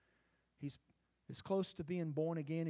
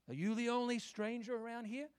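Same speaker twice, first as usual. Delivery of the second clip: higher, incredulous retort; indignant question; 7 semitones higher than usual. Acted. A disciple challenging the stranger, incredulous and higher. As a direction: throw the question back in disbelief. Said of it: "what he accused Jesus of".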